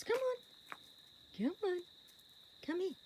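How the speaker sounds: high soft calls; high coaxing. Real, not acted. Owner coaxing her horse, high soft call. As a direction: coax him over, high.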